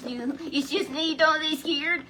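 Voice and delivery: calling out. Retold or imitated. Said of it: "he called out to them".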